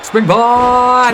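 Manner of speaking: high, loud hype call; excited shout. Real, not acted. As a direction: shout it out as the wrestler flies. Calling a big aerial move.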